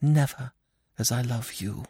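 soft, high, tender avowal; hushed woman's voice, pausing after the first word; whispered. A narrator's reading. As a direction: breathe it to a lover. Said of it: "she whispered".

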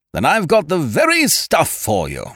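loud, high-pitched salesman's boom. A narrator's reading. Ukridge offering his tonic to the hostess, booming. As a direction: boom it out triumphantly. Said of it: "he boomed".

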